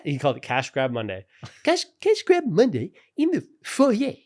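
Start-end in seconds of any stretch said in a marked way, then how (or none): 1.6-4.1 loud, very high stammering cartoon voice; Jimmy. Announcing the cash giveaway to staff, in loud Jimmy voice. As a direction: imitate an excited high character, catching on the first word. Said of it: "Jimmy moments".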